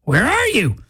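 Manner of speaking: hungry customer pleading with the late driver; impatient pleading. Pretend. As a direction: whine at the app, climbing in pitch. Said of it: "You're like".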